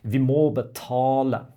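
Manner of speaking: Vi må betale with the å of må and the a of betale held long; long vowels in må and betale. Shown as a demonstration. Teacher showing a learner the long å and a. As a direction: draw out må and betale. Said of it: "Le o et le a, c'est beaucoup plus long".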